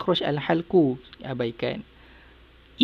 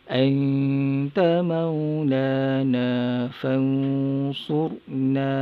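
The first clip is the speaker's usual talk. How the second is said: Quran recitation. Shown as a demonstration. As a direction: lead the class through the verse in recitation. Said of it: "Ikut. Satu, dua, mula".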